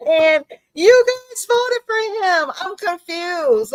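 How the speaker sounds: put-on high, slow, puzzled little boy; lightly voiced bewildered kid; child's voice. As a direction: speak as a confused young child. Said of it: "the little boy, he says".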